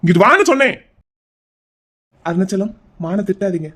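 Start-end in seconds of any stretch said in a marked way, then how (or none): 0.0-0.8 loud, raised-pitch command; angry order. Acted. Brother ordering his sister to come here, angry command. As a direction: order her over, tolerating no delay.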